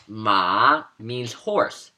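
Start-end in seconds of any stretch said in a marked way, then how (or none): 0.1-0.9 MA dipping, then rising; third tone. Shown as a demonstration. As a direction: say "ma" with a down-and-up pitch. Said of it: "The third tone".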